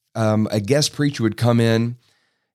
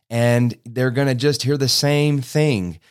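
ordinary talk

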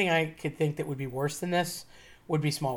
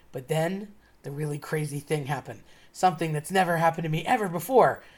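usual speaking voice

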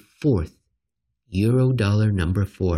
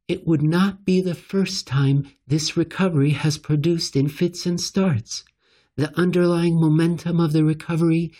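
higher, mock-official central banker voice; quoting Brainerd; 7 semitones higher than usual. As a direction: read her words back in a lifted, prim official tone. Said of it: "She said".

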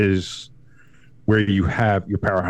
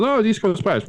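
higher mock whine; mocking complainers; 10 semitones higher than usual. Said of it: "everybody says".

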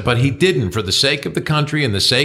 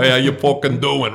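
put-on mild New Jersey tough-guy accent; Joey Buttafuoco impression; 2 semitones higher than usual. Brother-in-law mocking a Jersey accent, light New Jersey voice. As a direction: lightly mimic a Jersey guido greeting. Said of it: "Who sounds dumber than Joey Buttafuoco?".

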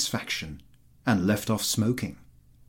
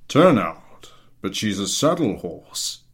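gruff general; 5 dB louder than usual. A narrator's reading. General answers, puzzled.

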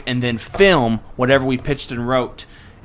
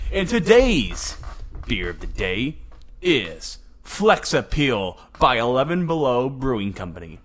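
ordinary talk

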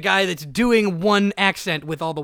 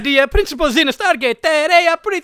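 high, louder cartoon Italian accent; comic Italian accent; 6 semitones higher, 6 dB louder than usual. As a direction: play a hammed-up Italian expert. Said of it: "fourteen dialects of Italian".

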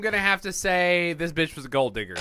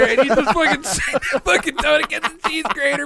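laughing; 9 semitones higher than usual. Real, not acted. Laughs while describing grating toads.

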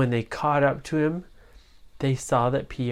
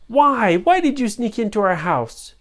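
demanding high voice; 8 semitones higher, 6 dB louder than usual. Acted. Family demands answer.

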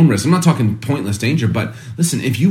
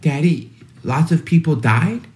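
put-on a light little boy's voice, higher and slower; son's voice; 5 semitones higher than usual. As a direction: mildly play the five-year-old son asking, puzzled. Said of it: "he goes".

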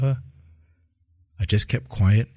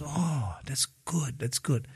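imitating mother's hushed, relieved reply; soft relief; 2 semitones higher, 11 dB quieter, slower than usual. Retold mother hears good news.

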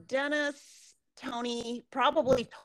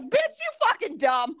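mocking laugh; 6 semitones higher, 4 dB louder than usual. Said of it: "laughing and pointing at her like".

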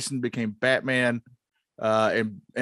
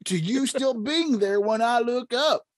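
put-on old prospector; 9 semitones higher than usual. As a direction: play Gordon as a grizzled old prospector. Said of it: "the part of Gordon will be played by the old prospector".

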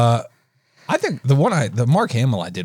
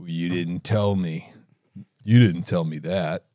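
lower, slow gruff Han Solo voice; lower, slower Han Solo impression; Mark Hamill doing Harrison Ford; 5 semitones lower, 3 dB quieter than usual. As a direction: play Hamill imitating Ford. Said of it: "That was Mark Hamill doing Harrison Ford".

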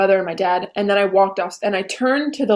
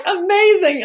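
high, louder gushing amazing; 10 semitones higher, 4 dB louder, slower than usual. Retold or imitated. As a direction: sing out amazing. Mom's verdict.

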